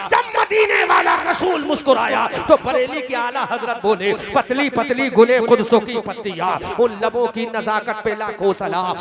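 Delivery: loud, fervent sermon delivery; preaching at full force. Acted. Preacher to the gathering, fervent loud declaiming. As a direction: declaim the verse to the crowd. Preacher recites Ala Hazrat's verse.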